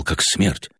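plain reading voice

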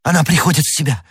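high, quick, relieved cry; joyful exclamation. Acted. D'Artagnan sees her reviving, joyful exclamation. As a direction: exclaim with sudden hope. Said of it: "воскликнул юноша".

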